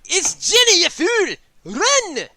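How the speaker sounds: high, loud Scottish shout; Scottish accent. Acted. Little blue man tells them to run, high Scottish yell. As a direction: yell a frantic Scottish warning. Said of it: "It's Scottish".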